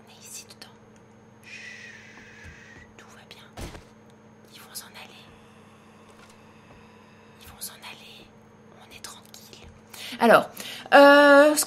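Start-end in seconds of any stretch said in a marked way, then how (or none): 0.1-9.7 chuchotement sans voix, tout bas; chuchote en se cachant. Real, not acted. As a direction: souffle les mots pour ne pas être entendue. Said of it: "sans faire de bruit". (chuchote cachée)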